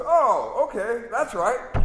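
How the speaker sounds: a dim sidekick suddenly satisfied; gullible sidekick. Retold or imitated. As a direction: naive delighted agreement. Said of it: "The not too bright guy goes".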